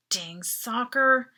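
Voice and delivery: plain reading voice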